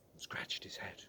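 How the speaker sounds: soft whispered aside; hushed narration. A narrator's reading. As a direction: draw listeners in quietly. Narration dropped to hush.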